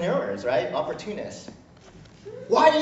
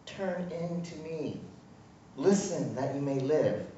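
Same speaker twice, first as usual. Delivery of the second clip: tender God; 6 semitones lower, 6 dB quieter than usual. Retold or imitated. He's caressing our cheeks.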